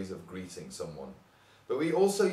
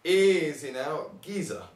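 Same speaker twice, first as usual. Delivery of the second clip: drawn-out, raised Cockney greeting; London Cockney; 6 semitones higher, 4 dB louder, slower than usual. Shown as a demonstration. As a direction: greet a mate in slow Cockney. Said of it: "if it's London Cockney".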